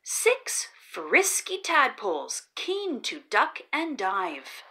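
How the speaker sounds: ordinary delivery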